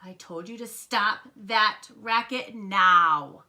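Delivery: loud, slow, emphatic order; stern scolding. A narrator's reading. Dad fed up with the thumping, slow loud scolding. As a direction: build word by word to a loud end.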